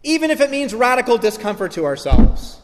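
rising, loud closing blessing over music; closing benediction. Acted. Preacher blessing the congregation at the close, rising and fervent. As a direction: lift it into a final blessing.

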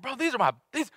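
high, pleading outrage over his bananas; offended banana seller. Retold or imitated. Vendor insulted by customer, protests in high outraged voice. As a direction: protest like a proud vendor defending family fruit. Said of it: "He's like".

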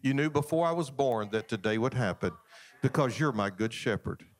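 calm, low-pitched talk to a Father; acted trusting prayer. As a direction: pray it evenly and unhurried, accepting the bad news. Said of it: "walk out of there and say".

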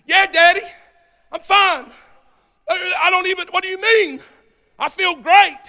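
high, loud reply to Daddy; acted young son. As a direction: play the healed boy, pitched well up. Preacher voices son answering his father.

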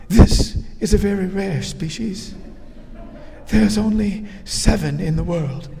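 hushed nature-documentary narrator; doing husband's Attenborough bit; David Attenborough impression. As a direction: mimic the wildlife documentary host, grave and hushed. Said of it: "Nigel has a great A-, David Attenborough, uh, impression".

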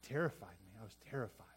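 low, soft scared confession; terrified whisper. Retold or imitated. David confessing fear, low and soft. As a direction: admit the fear quietly. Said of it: "he says".

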